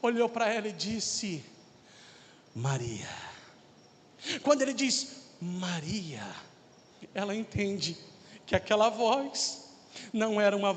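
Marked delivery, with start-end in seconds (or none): 2.5-3.1 low, soft, tender calling of a name. Retold or imitated. Jesus looks at Mary and says her name, tender. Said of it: "com aquele olhar de ternura". 5.4-6.2 much lower and softer, a gentle naming; retold tender call, hushed and low. Jesus speaks to Mary at the tomb, tender. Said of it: "com aquele olhar de ternura".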